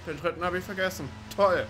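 ordinary talk

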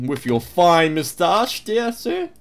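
butter up the gentleman; mock posh flattery. Border guard flattering a moustached traveller, mock posh.

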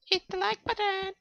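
tiny squeaky high voice. Acted. Joking to viewers about likes in a squeaky high voice. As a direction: pipe it out like a cartoon critter.

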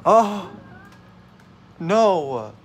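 high startled cry; alarmed. Real, not acted. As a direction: yelp of alarm. Something goes wrong in game.

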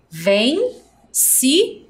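vencido stretched syllable by syllable; slow syllables. Shown as a demonstration. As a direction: count it out slowly. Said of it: "Quantas sílabas". (devagar, sílaba por sílaba)

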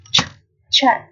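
ch- alone as a strong "tch", then chat; ch- sounded separately before chat; heavy CH sound. Shown as a demonstration. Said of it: "trở nặng nhá".

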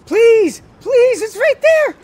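high, loud pleading screams; desperate scream. Real, not acted. Gamer screaming at the timer near the goal, desperate. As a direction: beg the game, screaming.

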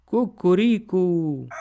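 slow, high drawn-out cock crow; rooster crow. A narrator's reading. The third roosters crowing at dawn, slow high cock crow.

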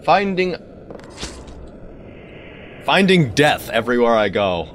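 ordinary talk

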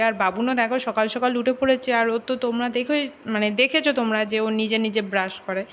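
ordinary talk, nothing marked

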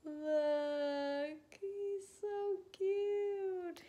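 high slow tender coo. Real, not acted. Showing sleeping baby.

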